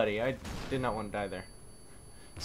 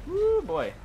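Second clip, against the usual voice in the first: excited whoop; 4 dB louder than usual. Real, not acted. Relief after close call.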